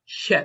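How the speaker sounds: "he" with a throaty pushed h; emphatic "he" from deep in the throat. Shown as a demonstration. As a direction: breathe out a hard "he". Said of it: "symbol for the emphatic H".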